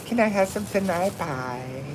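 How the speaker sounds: a hint of a small child begging sweetly; cute little kid. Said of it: "sound cute if a little, little kid said it".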